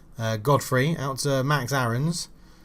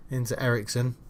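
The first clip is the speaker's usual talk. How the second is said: ordinary delivery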